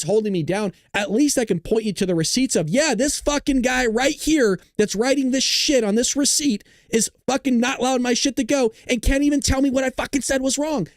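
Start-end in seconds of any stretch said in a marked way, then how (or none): 2.7-10.9 higher, pushed rant against the platform; heated rant. Real, not acted. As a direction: vent it at the company, voice climbing. Ranting, quoting himself at platforms.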